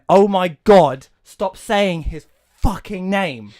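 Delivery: loud, slow, drawn-out complaint; exasperated outburst. Real, not acted. Griping to the co-host about the film, slow loud groan. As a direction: stretch every word in exasperation. Ranting about repeated name.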